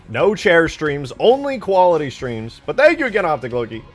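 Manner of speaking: loud, high, emphatic cheer; exuberant hype. Real, not acted. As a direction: belt it out with big excitement. Exuberant cheer for stream and donor.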